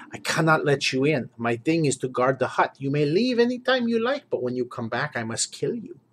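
put-on Iobarian warlord with a heavy Slavic accent; gruff guardian in a Slavic accent, regretful; Slavic-accented door guard warning the party. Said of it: "Ratbor talks like this, with this accent".

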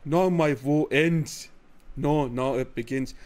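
put-on slow, low hardcore Scottish accent; mock Scottish accent. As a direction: growl it like a Scottish swordsman. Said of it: "hardcore aksan, İskoç aksanı yapmış".

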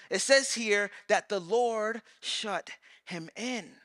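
normal talking voice

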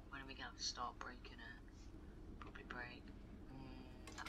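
hushed unvoiced whisper. Real, not acted. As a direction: whisper so no one hears. Hiding, speaking low.

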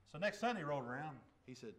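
ordinary talk, nothing marked

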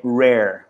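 rare with a curled American R; rare, R bem enrolado; rare, rolled R. Shown as a demonstration. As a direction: roll the R in rare slowly. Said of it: "olha essa pronúncia".

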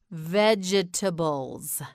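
"Vegetables" slowly, all four syllables sounded. Shown as a demonstration. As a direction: draw out "Vegetables" as veg-e-ta-bles. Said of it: "You say it".

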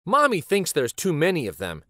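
ordinary delivery

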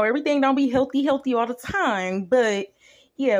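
ordinary delivery

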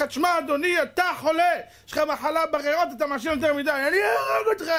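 a doctor losing patience; mock doctor. Doctor warns a smoking patient, high shouting.

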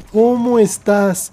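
loud, high, drawn-out hello; retold delighted greeting. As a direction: greet a long-lost friend, stretching it.

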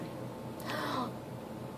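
sharp surprised breath in; a quick delighted gasp. Real, not acted. Fan spotting the bonus chapter, sudden gasp.

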